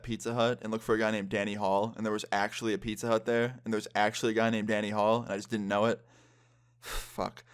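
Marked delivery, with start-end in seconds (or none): 6.4-7.4 breathy exasperated whisper; sighed whisper. Real, not acted. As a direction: let it out on a sigh. Sighing at the thought.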